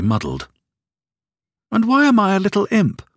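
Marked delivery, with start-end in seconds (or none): 1.7-3.0 put-on high, quick childlike voice; small boy asking grandmother, high and quick; child's question. A narrator's reading. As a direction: ask it like an eager small boy.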